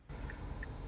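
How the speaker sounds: long weary exhale; heavy sigh. Real, not acted. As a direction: let out a tired sigh. Disappointed sigh alone.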